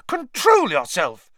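high, loud, drawn-out scolding; shrieking rebuke. A narrator's reading. Matron ordering a professor to behave, shrieking. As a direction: shriek a slow, outraged order. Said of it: "shrieked Madame Pumphrey".

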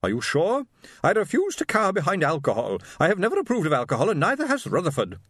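high and fast with righteous disapproval; haughty lady refusing the beer barrels; prim teetotal matron. A narrator's reading. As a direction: rattle off your temperance principles. Wife voiced in story.

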